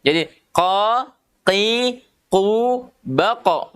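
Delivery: qa, qi, qu said slowly with the tongue back and lips still; teacher drilling qa qi qu; qaf syllables drill. Shown as a demonstration. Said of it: "Harus ini yang fokus".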